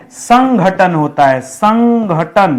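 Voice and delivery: संगठन drawn out, each syllable separate; slow clear demo. Shown as a demonstration. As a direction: spell संगठन slowly for students. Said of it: "teacher repeats correct word slowly". (संगठन को धीरे-धीरे, अलग-अलग अक्षर में)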